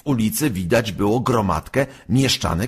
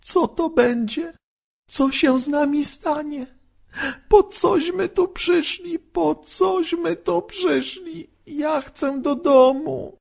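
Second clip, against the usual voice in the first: tearful wail. A narrator's reading. Halszka wails to her brother in tears, very high voice. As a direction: whimper you want to go home. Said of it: "załkała Halszka".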